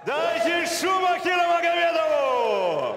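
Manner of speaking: high, loud, slow rally cry; crowd-hyping shout. Real, not acted. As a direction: pump up the arena. Комментатор заводит зал. (громкий призыв к залу)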